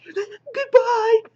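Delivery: very high hammed-up sobbing; mock crybaby. X-Man leaving her team, mock tearful farewell. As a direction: wail it in a squeaky pretend cry. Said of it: "when did she become this whiny crybaby".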